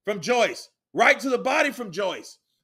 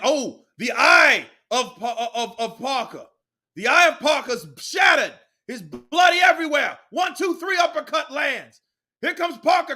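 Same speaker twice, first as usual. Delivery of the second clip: excited shouted call; 4 semitones higher than usual. Real, not acted. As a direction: shout the action as it happens.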